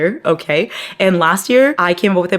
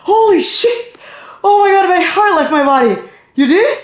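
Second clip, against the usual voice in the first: much higher, laughing and louder; shocked; 13 semitones higher, 5 dB louder than usual. Real, not acted. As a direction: gasp it out laughing. Startled by roommate.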